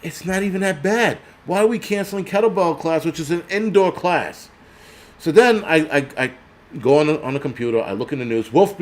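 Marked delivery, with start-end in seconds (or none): 0.0-4.5 indignant. Retold or imitated. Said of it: "I'm like".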